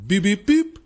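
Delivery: high-pitched robot beeps; quick imitated electronic beeps; robot beeping. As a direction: beep like a little delivery robot.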